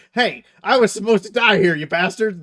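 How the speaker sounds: high, loud indignant yell; outraged Gotrek shout. Retold or imitated. Gotrek to the ghosts who spared him, outraged shout. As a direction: yell it as a comic outburst of grievance. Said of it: "Gotrek looks at them and goes".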